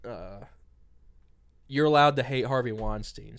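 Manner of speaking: ordinary delivery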